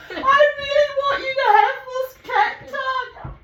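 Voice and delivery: high, loud imitated pleading; hammed-up panicky plea through laughter; mock frightened plea. Big man shaking like a leaf, begging her to take the cat, mock panic. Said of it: "he starts shaking like a leaf".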